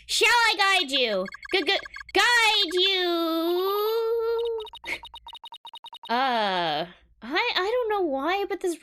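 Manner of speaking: high, loud robot voice with drawn-out words; high robotic sing-out, stretching the vowels; eager guide robot. Acted. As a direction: offer help as a slow, stuck robot. Voices robot character in game.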